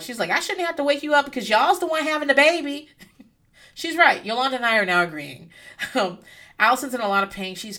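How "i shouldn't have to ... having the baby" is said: mock yell. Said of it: "Yolanda yells at him".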